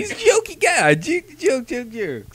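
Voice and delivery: high, bouncy singsong; mock jokey singsong. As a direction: tease it in a jaunty lilt. Mocking jokey guy.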